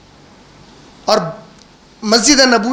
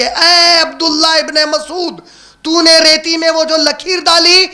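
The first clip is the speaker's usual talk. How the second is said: higher, measured, ringing sermon voice; solemn preaching warning; 6 semitones higher than usual. Acted. Preacher retells the Prophet's warning to his companion, solemn and raised.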